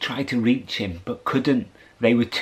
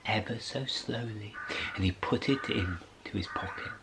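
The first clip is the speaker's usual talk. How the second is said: soft unvoiced whisper; 13 dB quieter than usual. A narrator's reading. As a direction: whisper it like a secret. Narrating slow secret pocketing.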